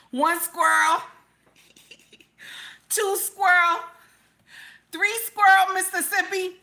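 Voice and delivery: high, loud mock counting; mock squirrel counter. Host mimicking people paid to count squirrels, high mock counting. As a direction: count out each one in a high, incredulous voice.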